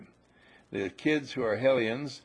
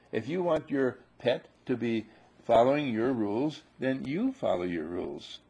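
normal talking voice

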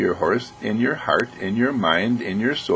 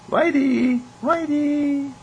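put-on much higher, childlike calling of the name; little child calling; much slower than usual. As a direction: call the horse like a small kid. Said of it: "a little child walks into the stable".

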